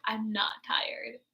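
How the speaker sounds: unvoiced whisper, slow. Shown as a demonstration. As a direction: breathe the words with no voice.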